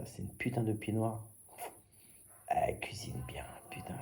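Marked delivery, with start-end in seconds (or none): 2.5-3.9 whispered aside. Real, not acted. Praising Denise's cooking to viewers, whispered confidentially. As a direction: lean in and whisper it.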